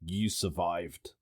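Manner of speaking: understated acknowledgement. Retold or imitated. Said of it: "nodded his head to say".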